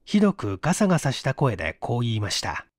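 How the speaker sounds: ordinary delivery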